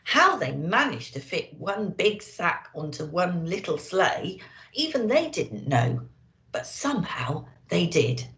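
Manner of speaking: usual speaking voice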